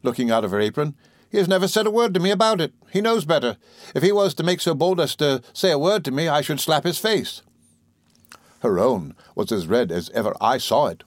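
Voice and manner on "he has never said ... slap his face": higher-pitched, mildly indignant protest; lightly voiced indignant servant; retort. A narrator's reading. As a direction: protest it as Peggotty, pitched well up. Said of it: "retorted Peggotty".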